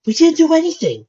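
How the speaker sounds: very high, loud protest; protesting boy. A narrator's reading. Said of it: "protested Dipper".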